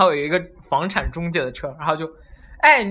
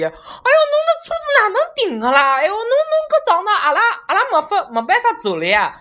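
high, shrill-voiced Shanghainese auntie scolding in dialect; Shanghai auntie accent; 5 dB louder than usual. Passerby auntie scolds the driver about parking, Shanghai accent. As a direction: play a fussy Shanghai auntie complaining in Shanghainese, much higher. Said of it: "然后就上海老阿姨".

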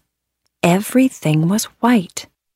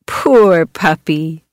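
slow, drawn-out and lower; slow pity; 3 semitones lower, much slower than usual. Acted. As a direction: sigh it out for the poor dog. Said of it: "said the kids".

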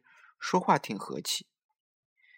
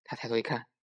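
usual speaking voice

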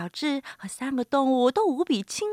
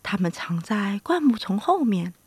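usual speaking voice

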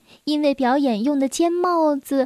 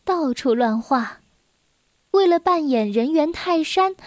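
plain reading voice

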